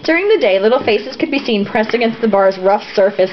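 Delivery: ordinary talk, nothing marked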